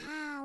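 long, soft demonstrated sigh; relieved sigh. Retold or imitated. Mother after swearing, imitated relieved sigh. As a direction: let out a savouring breath. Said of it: "un suspiro".

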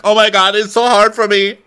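high, loud, hammed-up wail; mock whining lament. Mocking a cast member's party troubles, wailing. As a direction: wail it as if a party were torture.

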